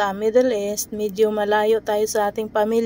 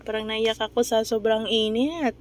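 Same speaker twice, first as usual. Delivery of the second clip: usual speaking voice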